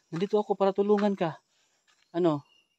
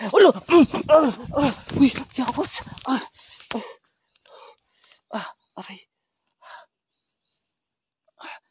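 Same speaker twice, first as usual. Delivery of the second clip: screaming; 8 semitones higher, 9 dB louder than usual. Acted. Attacked by the aswang.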